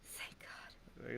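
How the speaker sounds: whispered aside in relief. Real, not acted. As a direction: breathe it out in a whisper. Relieved aside after his remark.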